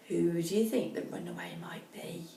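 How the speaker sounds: whispered, teasing question; whispering a question to young listeners; whispered aside. Acted. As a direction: lean in and whisper to the children. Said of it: "aside to listening children".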